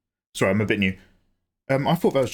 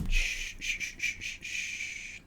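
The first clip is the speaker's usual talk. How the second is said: whispered shush; 11 dB quieter, much slower than usual. Pretend. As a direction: hush them in a fading whisper. Hushing chat's teasing question.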